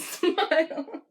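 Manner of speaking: high, laughing put-on baby voice; little baby voice. Harry with no teeth says it, mock baby voice. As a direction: squeak it like a cartoon baby. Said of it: "It's like a little baby".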